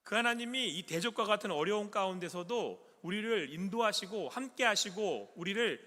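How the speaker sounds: ordinary talk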